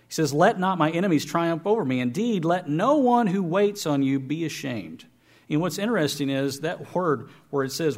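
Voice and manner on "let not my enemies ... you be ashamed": scripture reading. Acted. Reads scripture aloud.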